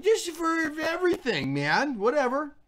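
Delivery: high-pitched, loud careless blurting; mock dismissive. Clueless would-be politician with no policies, mock dismissive. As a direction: toss it off like a clueless candidate.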